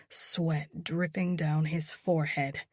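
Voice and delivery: plain reading voice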